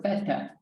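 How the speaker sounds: "theta" with a short e, THEH-tah; Greek pronunciation. Shown as a demonstration. Teacher showing how a Greek would say it, Greek pronunciation. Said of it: "if you're Greek".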